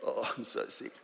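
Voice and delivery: soft, breathy laughing whisper of a sick client. Retold or imitated. Client confessing she's sick, in a laughing whisper. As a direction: mimic the sniffly client under a laugh.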